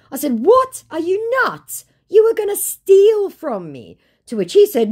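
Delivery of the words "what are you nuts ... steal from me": high, loud indignant cry; high outraged disbelief; outraged shout. Retold or imitated. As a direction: blow up in disbelief. Outraged at scammer.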